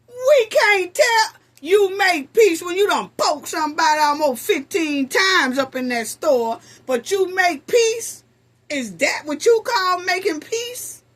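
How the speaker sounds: sarcastic loud rebuttal; incredulous. Real, not acted. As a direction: tear into the claim, mocking. Host ridicules her claim.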